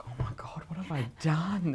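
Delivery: whispered oh-my-God moment; whispered panic. Retold or imitated. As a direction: whisper it in mock dismay. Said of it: "afterwards I was like".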